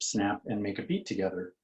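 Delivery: plain reading voice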